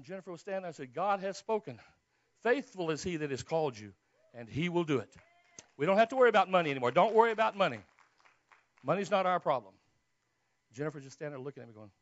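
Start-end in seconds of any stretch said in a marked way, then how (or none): 0.9-9.7 loud, exultant proclamation; preacher at full volume, raised voice; triumphant announcement. Retold or imitated. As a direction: burst out with the good news. Bursting out to tell wife.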